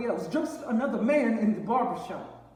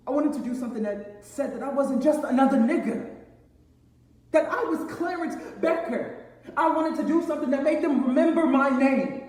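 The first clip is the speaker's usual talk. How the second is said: loud, higher, emphatic self-assertion; impassioned declaration; 3 semitones higher, 5 dB louder than usual. Acted. Son telling Pop he is Clarence Becker, impassioned declaration. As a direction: build to a forceful, ringing finish. Son insisting on his own name.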